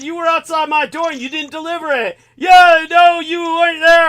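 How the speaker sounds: high, loud accusing yell; loud, high furious accusation; retold shout. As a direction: shout him down at the van. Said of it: "everything I was shouting at that guy".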